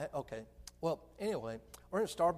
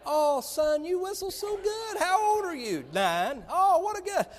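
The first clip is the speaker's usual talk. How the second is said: put-on high, loud gushing over a child; preacher voicing cooing ladies in line, high and gushing; gushing church ladies; 11 dB louder than usual. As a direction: coo over the little boy like delighted ladies. Ladies praising whistling boy.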